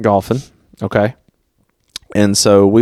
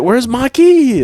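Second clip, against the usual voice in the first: mock panicked question; 4 dB louder, slower than usual. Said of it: "They're like".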